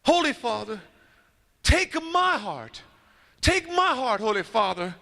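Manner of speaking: high, pleading cry to the Pope. Acted. Lone man in the square crying up to the Pope, pleading high. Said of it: "he just begins to cry out".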